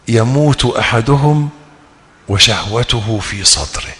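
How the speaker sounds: slow and soft. Acted. Sermon, unfulfilled desire.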